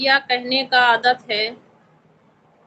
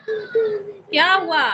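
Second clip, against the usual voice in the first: mock crying; 9 semitones higher, much slower than usual. Asking a crying viewer what happened.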